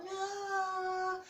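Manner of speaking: high, drawn-out crowd roar imitation; mock cheer of a wild crowd; crowd going wild. Crowd reacting to a quick arm bar win, going wild. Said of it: "The crowd goes wild".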